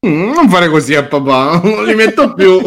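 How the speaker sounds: put-on sing-song parent scolding a child; high, scolding little dad voice; scolding daddy voice. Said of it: "Perché li metto con questo tono?".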